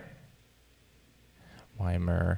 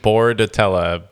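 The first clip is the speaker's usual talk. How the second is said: ordinary talk, nothing marked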